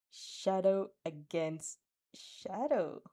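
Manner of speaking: ordinary delivery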